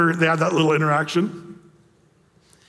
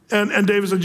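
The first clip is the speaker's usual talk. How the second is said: usual speaking voice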